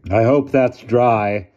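put-on slow, loud, imperious old lady. A narrator's reading. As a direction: speak like a commanding elderly aunt.

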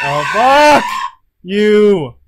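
panicked shout; panicking gamer. Real, not acted. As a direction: scream as she catches up. Monster right behind.

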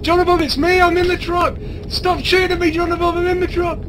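high, loud pleading call; frantic calling. Real, not acted. To a teammate shooting at him, frantic high calling. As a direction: call out desperately so he stops shooting.

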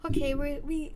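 normal talking voice

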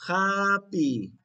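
loud, stretched "Happy"; emphatic two-beat "Happy"; model answer. Shown as a demonstration. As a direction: call out "Happy" for children to echo. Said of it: "¿Y cómo está?".

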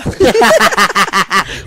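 real laugh breaking out; amused laughing fit; burst of laughter. Real, not acted. Laughing at accent joke.

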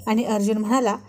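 usual speaking voice

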